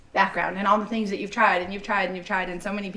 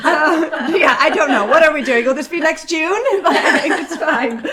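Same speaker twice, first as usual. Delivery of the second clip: laughing; 6 semitones higher, 8 dB louder than usual. Real, not acted. Laughing at Christmas outfits.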